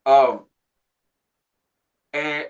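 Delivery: ordinary talk, nothing marked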